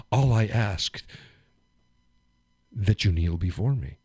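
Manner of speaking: low, imperious royal command; commanding god king. Retold or imitated. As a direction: demand they kneel, lordly. God king demands kneeling.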